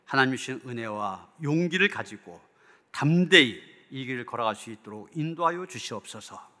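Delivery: ordinary talk, nothing marked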